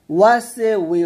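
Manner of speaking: ওয়াসে উই with a vowel after the s; wrong pronunciation. Shown as a demonstration. Teacher shows what to avoid, ওয়াসে উই with added vowel. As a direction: link ওয়াসে উই through an extra "e". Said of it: "হবে না".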